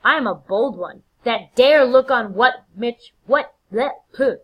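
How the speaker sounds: loud, high, blustering boast that trips; overexcited. Acted. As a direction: boast so eagerly that you tumble over the words. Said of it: "I got excited".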